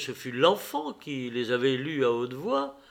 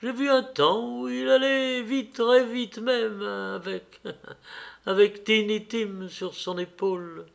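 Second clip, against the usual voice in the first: higher-pitched mother putting on cheer; lightly acted gaiety with a small break; firm, cheerful voice, faltering once; 7 semitones higher than usual. A narrator's reading. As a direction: keep it brightly brave, let it catch midway. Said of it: "reprit d'une voix ferme, dont le ton de gaieté".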